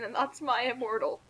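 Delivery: usual speaking voice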